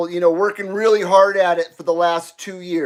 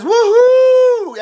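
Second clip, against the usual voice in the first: much higher and louder whoop of joy; excited whoop; 13 semitones higher, 6 dB louder than usual. Pretend. Host reading out a fan's cheer, high and loud. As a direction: cheer it like the fan would.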